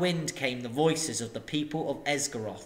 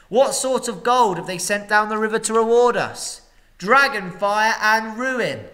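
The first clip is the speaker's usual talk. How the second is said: loud, higher orator; loud and clear; 7 semitones higher, 7 dB louder than usual. A narrator's reading. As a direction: declaim it to the crowd. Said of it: "spoke very loud and clear".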